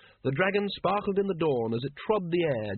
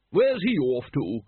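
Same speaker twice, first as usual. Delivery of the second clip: slow, booming call, a little louder; 5 dB louder, slower than usual. Acted. Lady Ramkin calls after the dragon, slow and booming. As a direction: boom it out across the yard. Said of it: "boomed Lady Ramkin".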